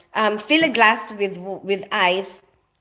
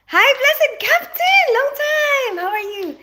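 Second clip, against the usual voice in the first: high, slow, drawn-out sing-song hello; sing-song greeting; 5 dB louder than usual. Real, not acted. Greeting a regular viewer in a high, slow sing-song.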